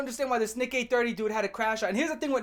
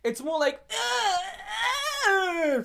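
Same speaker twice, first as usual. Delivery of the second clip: high, crying whimper; mock whimper; 10 semitones higher, slower than usual. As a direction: whimper like a sulking kid. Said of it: "type of crash out".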